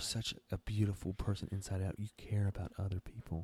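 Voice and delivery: breathy whispered mock awe; mock reverent whisper. Mocking admirers of the vaccinated, in a hushed awed whisper. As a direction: whisper it like hushed hero worship.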